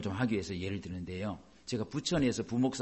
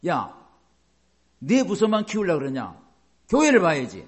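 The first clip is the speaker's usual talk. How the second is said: loud retold scolding from the senior pastor, higher; 5 semitones higher, 7 dB louder than usual. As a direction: bark it out like a boss pastor rebuking a junior. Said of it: "막 야단치는 거예요".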